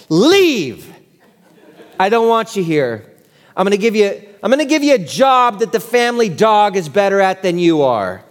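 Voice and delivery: a hint of a harsh dad sending his son off; lightly voiced rejecting father. Father telling David to leave, mildly acted rejection.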